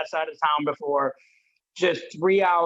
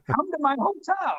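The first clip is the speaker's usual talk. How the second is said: high, showy come-on in his face; flamboyant excited; 9 semitones higher than usual. Retold or imitated. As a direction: pitch it big and flamboyant, pushing the invite. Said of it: "I'm flamboyant, like, just in his face, excited".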